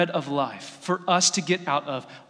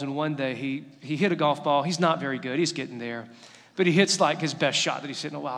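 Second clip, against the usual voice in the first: usual speaking voice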